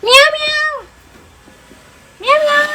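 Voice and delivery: high, playful meowing; cat meow. Pretend. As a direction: meow like a kitten to call the cat. Mother meows at the cat.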